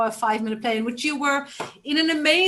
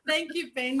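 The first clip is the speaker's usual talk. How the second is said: laughing; 7 dB quieter than usual. Real, not acted. Laughing thanks.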